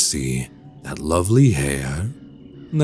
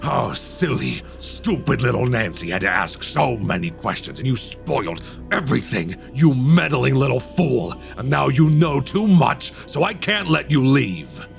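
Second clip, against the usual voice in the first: higher-pitched, seething rebuke building in pitch; menacing villain; 5 semitones higher than usual. Acted. Walter blames meddling Nancy as he transforms, menacing. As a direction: turn on your victim with mounting menace. Villain turns on her while changing.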